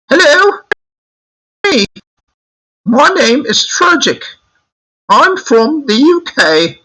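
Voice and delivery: very high-pitched mock British voice; British accent. Shown as a demonstration. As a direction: put on a squeaky falsetto British accent. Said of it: "my British accent".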